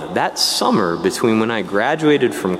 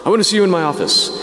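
brash summons; 8 semitones higher, faster than usual. Retold or imitated. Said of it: "that's the way that he talked".

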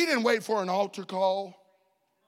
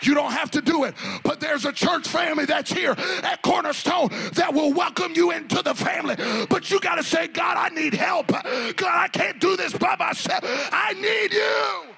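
preaching at full force; 6 semitones higher than usual. Acted. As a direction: shout it to the congregation.

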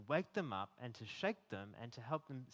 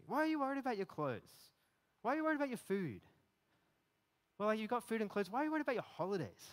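higher, insistent, incredulous questions; exasperated Jesus; 8 semitones higher, 3 dB louder, faster than usual. Retold or imitated. Jesus shaking a worried listener, higher exasperated questions. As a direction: rattle off the worries as if shaking someone awake. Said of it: "just imagine Jesus, like, shaking you".

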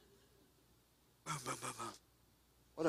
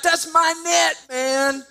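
shouted, much higher and louder; angry shout; 10 semitones higher, 6 dB louder, slower than usual. Retold or imitated. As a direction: yell it at the coach in protest. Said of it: "And he says".

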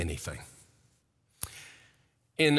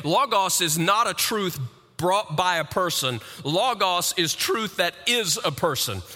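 higher, ringing sermon voice; full-force preaching; 7 semitones higher than usual. Acted. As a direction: preach it out with conviction.

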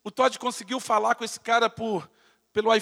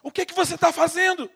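imitating angry man; 6 semitones higher, 3 dB louder than usual. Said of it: "o cara com raiva".

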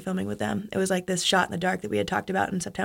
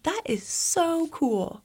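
slow, higher-pitched polite approval; underwhelmed mom; 8 semitones higher, much slower than usual. Retold or imitated. Mom who doesn't get skiing responds, mildly impressed. Mom's underwhelming reply imitated.

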